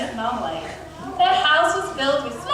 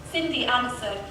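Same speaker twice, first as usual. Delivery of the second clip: ordinary delivery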